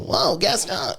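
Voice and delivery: quick high cartoon sidekick squeak; LeFou voice. Recalling his LeFou role, high sidekick voice. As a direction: chirp it like the little sidekick. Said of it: "character, the little".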